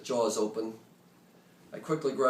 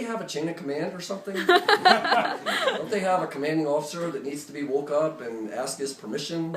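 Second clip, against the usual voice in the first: louder, laughing through the question; laughing disbelief; 5 semitones higher, 5 dB louder than usual. Real, not acted. Narrator marvelling at the Saudis firing at once, laughing. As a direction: chuckle in amazement as you ask.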